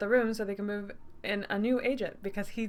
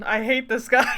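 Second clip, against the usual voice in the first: loud, laughing outburst; laughing shout; 4 semitones higher, 6 dB louder than usual. Real, not acted. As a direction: yell it through a laugh.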